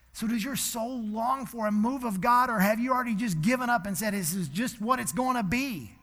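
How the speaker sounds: loud, high, shouting sermon climax; impassioned revival shout; preaching at full force. Acted. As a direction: cry it out to stir the congregation. Preaching climax about the nation.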